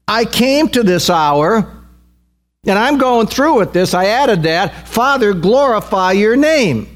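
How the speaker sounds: high-pitched, insistent sermon delivery; impassioned preaching. Real, not acted. Preaches with raised intensity.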